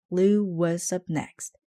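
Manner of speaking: usual speaking voice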